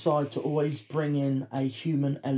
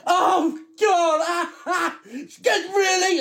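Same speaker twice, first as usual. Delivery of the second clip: high, loud mock heckling; hammed-up jeering at the screen; obnoxious cinemagoer; 12 semitones higher, 4 dB louder, slower than usual. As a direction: imitate a show-off making a noisy scene. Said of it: "you reacted so obnoxiously".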